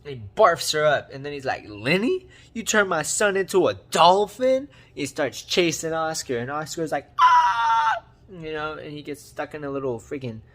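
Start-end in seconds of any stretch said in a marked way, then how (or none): 1.7-4.7 loud, raised-pitch disbelief; hammed-up angry question, booming and high; mock outrage. Voices furious father confronting Lenny. 7.2-8.0 very loud, high-pitched scream; hammed-up shriek of someone being chased; imitated terrified squeal; mock scream.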